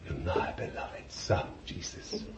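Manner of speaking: hushed, tender whisper; almost no voice, all breath; whispered blessing. Acted. The Father blessing his Son in a whisper.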